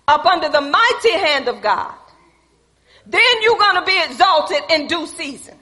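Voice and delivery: loud, punched scripture exhortation; full-force preaching. Real, not acted. Preacher urging people to humble themselves, loud and emphatic. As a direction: press the command on the congregation, forcefully.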